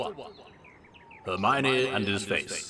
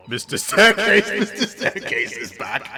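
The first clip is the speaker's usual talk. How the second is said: giggling through the name; laughing; 8 semitones higher, 5 dB louder than usual. Real, not acted. Reader amused by the absurd Mister Staircase, giggling. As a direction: read it while cracking up.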